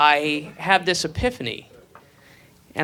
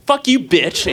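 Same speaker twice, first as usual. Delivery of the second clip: mock tough; 4 semitones higher, 5 dB louder, slower than usual. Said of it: "no danger of me going".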